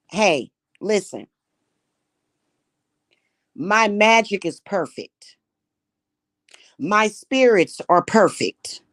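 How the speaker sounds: normal talking voice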